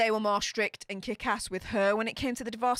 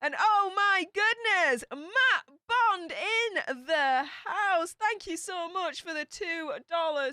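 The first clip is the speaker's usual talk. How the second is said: excited welcome; 6 semitones higher, slower than usual. Real, not acted. Streamer welcoming Matt Bond to the chat, hyped and high. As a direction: announce him like he just walked in.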